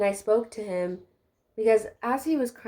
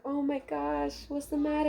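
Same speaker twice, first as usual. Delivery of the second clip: a light high, soft concerned voice; mild mock of a worried friend; 6 semitones higher, 4 dB quieter than usual. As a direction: imitate her gentle worry. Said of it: "she was like".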